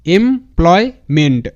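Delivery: slow, three separate chunks; syllable by syllable. Shown as a demonstration. Teacher assembles the spelled parts for students, syllable by syllable. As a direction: sound out employment part by part with short pauses. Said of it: "ভাঙবো তারপরই উচ্চারণটা করব".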